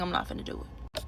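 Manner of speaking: breathy whispered aside, dropped low. Real, not acted. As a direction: slip it out in a whisper to yourself.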